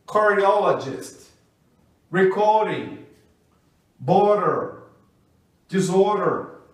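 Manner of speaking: each word said slowly with the T or D tapped like an R; flap word drill. Shown as a demonstration. Teacher modelling flapped D words for students to repeat. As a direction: read the list clearly, pausing after each word. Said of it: "vamos ver mais exemplos do D com som de R".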